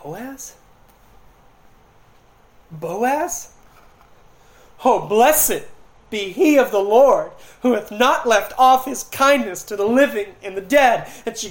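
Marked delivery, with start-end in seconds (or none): 0.0-3.5 slow questioning Boaz, dawning realization; dawning surprise. Acted. Naomi realizing, rising questions. 4.8-11.1 louder, lifted praise of the Lord; joyful blessing. Acted. Naomi's blessing voiced.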